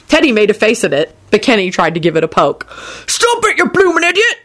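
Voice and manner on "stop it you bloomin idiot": high-pitched, urgent telling-off; snapped scolding. A narrator's reading. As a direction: snap at him to stop, pitched high. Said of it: "snapped Hagrid".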